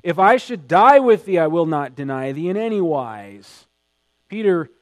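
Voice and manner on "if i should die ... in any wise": Peter's loud, insistent vow; vehement protest. A narrator's reading. Preacher reading Peter's vow to Jesus, vehement. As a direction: read Peter's vow with force. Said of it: "he spake the more vehemently".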